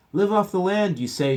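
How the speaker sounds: high, loud sarcastic shout; shouting Mama. A narrator's reading. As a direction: yell it back while running. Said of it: "shouted Mama".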